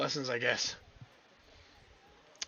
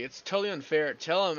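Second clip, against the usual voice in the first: ordinary delivery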